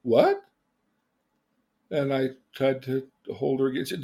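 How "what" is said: loud, bewildered reaction; mock startled husband; taken aback. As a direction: blurt it out, confused and pitched up. Startled husband after rebuff.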